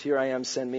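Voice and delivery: low, soft, understated delivery; subdued quote. Acted. Preacher showing where stories get cut off, subdued. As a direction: say it quietly, without any fervour. Quoting the cut-off story soberly.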